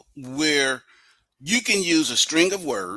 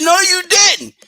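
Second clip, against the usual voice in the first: mock protest; 8 dB louder than usual. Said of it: "I say".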